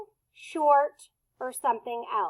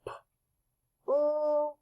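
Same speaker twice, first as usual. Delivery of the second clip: usual speaking voice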